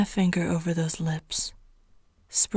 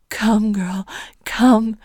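urgent command; 7 dB louder, slower than usual. Acted. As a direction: whisper building to a loud command. Urging toward climax, louder.

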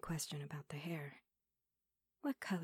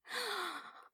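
sharp high gasp; 13 semitones higher, 3 dB quieter than usual. Acted. Sudden gasp of pleasure.

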